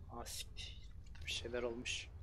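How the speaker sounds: hushed swear, no voice; breathless whispered curse; startled whisper. Real, not acted. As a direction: whisper it in shock. Creeping through the scene.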